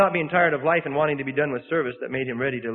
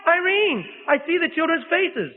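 much higher, very slow, in awe; dying Moody calls to his family in ecstatic joy; joyful rapture; 12 semitones higher, slower than usual. A narrator's reading. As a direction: cry out in wonder at a vision. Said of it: "in a voice of joyful rapture".

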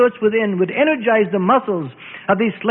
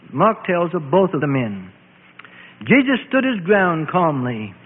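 ordinary delivery

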